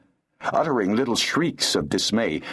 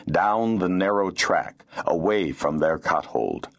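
plain reading voice

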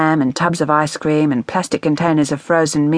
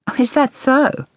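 sarcastic; 9 semitones higher than usual. A narrator's reading. Mother's sarcastic retort.

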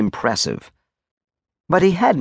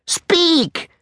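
very high barked order; impatient command. Acted. Major cutting off the soldier, impatient and very high.

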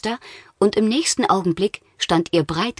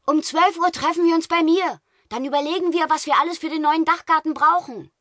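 calling out; 11 semitones higher than usual. Acted. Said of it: "als Kai rief".

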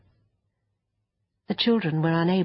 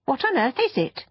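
put-on high-pitched, wondering child; curious little girl, voice lifted high; astonished girl; 8 semitones higher than usual. Child astonished at strange creature.

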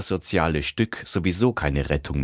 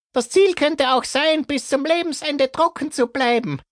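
high, thin woman's voice; character voice; 3 dB louder than usual. Acted. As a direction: offer a hopeful idea to the group. Barbara speaks in group.

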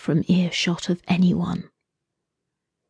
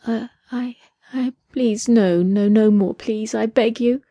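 higher, halting, frightened pleading; terrified begging; 4 semitones higher than usual. A narrator's reading. Captive woman begging the man, terrified pleading. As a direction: stammer out a desperate plea.

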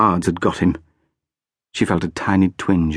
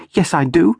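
put-on fierce little girl; 9 semitones higher than usual. A narrator's reading. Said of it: "always insisted fiercely".

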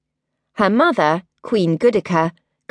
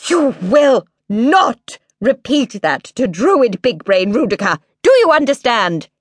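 stern warning; 6 semitones higher, 4 dB louder than usual. Acted. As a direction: lay down the law to the child. Queen appalled, forbidding.

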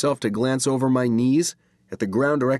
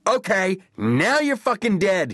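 high, raised-voice threat; angry comeback; 10 semitones higher, 3 dB louder than usual. A narrator's reading. Brother below hurling a comeback, angry. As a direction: hurl the threat back in a high voice. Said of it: "hurled comebacks like he hurled rocks".

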